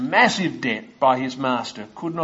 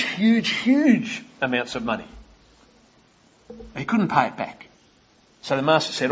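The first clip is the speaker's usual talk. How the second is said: ordinary talk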